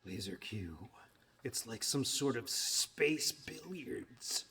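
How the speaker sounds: slow breathy whisper. Real, not acted. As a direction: hush it like a secret aside. Hushed aside about the game.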